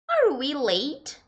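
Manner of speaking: slow, careful English example; slow model sentence. Shown as a demonstration. Teacher reads the English example slowly to students. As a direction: spell it out slowly for learners.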